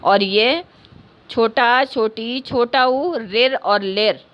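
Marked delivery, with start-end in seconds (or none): 3.2-4.2 रिर और लेर said slowly and clearly for students; धीमा, स्पष्ट उच्चारण; slow vowel demo. Shown as a demonstration. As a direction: sound out रिर and लेर deliberately. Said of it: "पाँच हैं पूरे".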